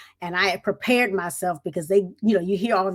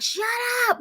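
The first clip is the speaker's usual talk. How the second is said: mock yelled command; screaming sergeant. Sergeant silences the chattering new recruit, screaming. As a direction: cut her off with a retold shout. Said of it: "he starts screaming at me".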